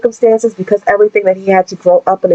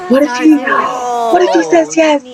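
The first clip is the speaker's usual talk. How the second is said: real excitement; 6 semitones higher than usual. Real, not acted. Gamer watching her Sim propose, excited squeal. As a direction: blurt it out in hope, much higher.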